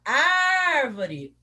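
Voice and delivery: ÁRVORE with a long high ÁÁÁR; calling the word. Shown as a demonstration. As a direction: call ÁRVORE, holding the first syllable. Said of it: "chamando a palavrinha".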